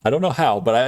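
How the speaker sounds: normal talking voice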